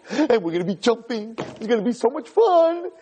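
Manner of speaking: high-pitched, gleeful anticipation; giddy fish. Acted. Netted fish expecting a party boat, high giddy squeal.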